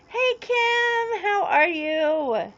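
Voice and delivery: high sing-song hello, drawn out slowly; cheery greeting. Real, not acted. Greeting a viewer in chat.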